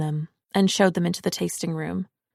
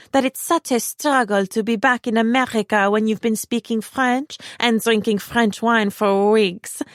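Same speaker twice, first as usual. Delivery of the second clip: put-on higher, louder affected French accent; heavy French accent; 4 semitones higher, 5 dB louder than usual. A narrator's reading. Parker showing off to guests in a heavy French accent. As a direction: lay on a pretentious French accent. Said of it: "She slipped into a heavy French accent".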